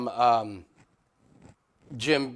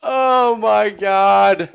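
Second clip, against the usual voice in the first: loud, higher, drawn-out cry of amazement; amazed shout; 7 semitones higher, 12 dB louder, much slower than usual. Real, not acted. Watcher stunned by the called finish, amazed shout. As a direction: belt it out in disbelief.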